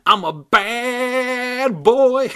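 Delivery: very high, very slow, laughing puppet voice; cackling bad boy dummy. Dummy bragging to Brittany with a high cackle. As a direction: stretch it out, gleeful and wicked.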